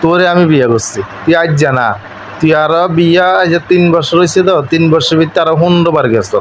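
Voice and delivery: ordinary talk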